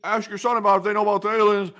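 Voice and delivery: high, loud, put-on doddering old man. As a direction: ham up a rambling old politician. Reading a chat quote in voice.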